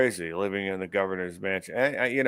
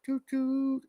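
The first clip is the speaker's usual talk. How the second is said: mock train whistle; 14 semitones higher, 6 dB quieter than usual. On the Trump train.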